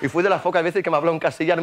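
ordinary talk, nothing marked

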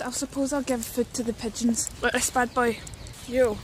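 ordinary talk

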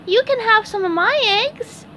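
high-pitched kindly offer; sympathetic SpongeBob. A narrator's reading. SpongeBob feels sorry for Patrick and offers eggs, sympathetic. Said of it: "SpongeBob felt sorry for Patrick".

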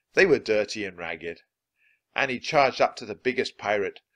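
plain reading voice

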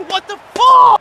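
high, loud cry of disbelief; real shocked shout. Real, not acted. Gamer shouts at a bad play.

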